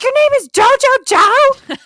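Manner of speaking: very high, loud, drawn-out disbelief; mock shock. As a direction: shriek the question as an imitation of stunned people. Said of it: "It was like the shock of the century".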